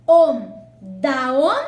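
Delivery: "om", "Daón" called out loud as one fused syllable; loud syllable demo. Shown as a demonstration. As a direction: bark out the joined syllable "Daón". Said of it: "Entonces se une da".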